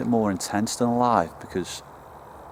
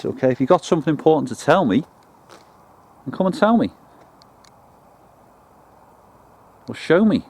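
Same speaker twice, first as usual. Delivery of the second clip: loud, higher-pitched call to an unseen presence; 4 semitones higher, 7 dB louder than usual. Real, not acted. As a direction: project it, inviting them to answer. Calling out to unseen spirits.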